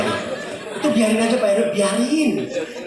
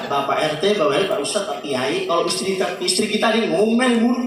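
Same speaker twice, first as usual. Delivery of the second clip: ordinary talk, nothing marked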